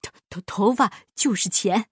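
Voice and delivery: stammering solemn insistence; self-important miser voice; earnest. A narrator's reading. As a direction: state it in all seriousness, tripping on the first word. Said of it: "巴依老爷一本正经地说".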